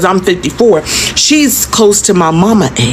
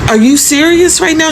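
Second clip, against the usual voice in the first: high, disbelieving question; stunned disbelief at the old woman's words; incredulous; 9 semitones higher than usual. Retold or imitated. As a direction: ask it as if you can't believe your ears.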